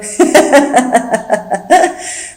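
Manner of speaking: a long helpless giggle; maid's giggle. Acted. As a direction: laugh in his face. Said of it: "Nicole n'arrête pas de rire".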